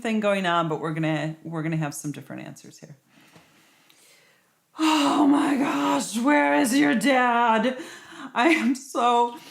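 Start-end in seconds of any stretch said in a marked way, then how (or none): 4.8-9.4 slow, loud, higher frazzled outburst; overwhelmed mom at the end of her rope; frantic exasperation. Acted. Frazzled wife greets late husband.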